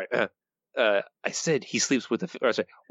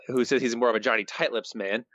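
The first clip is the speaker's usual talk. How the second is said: normal talking voice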